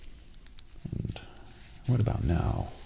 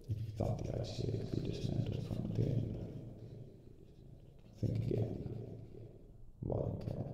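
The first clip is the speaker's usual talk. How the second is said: fading menace; 11 dB quieter than usual. Acted. Villain's parting threat.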